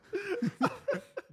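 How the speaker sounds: risa muy aguda; carcajada. Real, not acted. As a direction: risa real, larga, tras la frase. Se ríe contando la anécdota. (carcajada al recordar el consejo)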